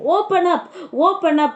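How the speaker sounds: mock harsh shout. Teacher imitating police at the front door, mock harsh shout. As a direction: lightly act out a rough demand. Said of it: "started to shout in a harsh voice".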